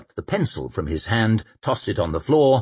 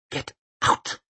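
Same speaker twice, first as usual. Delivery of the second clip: hiss. A narrator's reading. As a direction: hiss it through your teeth. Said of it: "she hissed".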